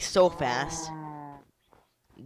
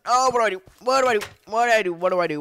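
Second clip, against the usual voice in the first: loud, high, frantic repeats; frantic yelp settling down; panicked repeating; 7 semitones higher, 10 dB louder, faster than usual. Real, not acted. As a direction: panic out loud, dropping in pitch as you go. Panicking as skeleton attacks.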